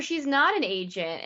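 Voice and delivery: ordinary talk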